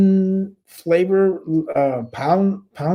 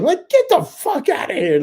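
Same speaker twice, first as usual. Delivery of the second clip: incredulous scoff. Real, not acted. Scoffing at doctor's advice.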